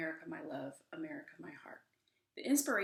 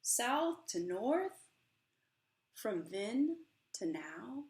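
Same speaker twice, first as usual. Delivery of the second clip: slow, swelling spoken-word performance of a poem; dramatic poem reading; 5 semitones higher, 8 dB louder, slower than usual. Acted. Author reads her book to the audience, impassioned poem reading. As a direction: perform the poem with rising passion.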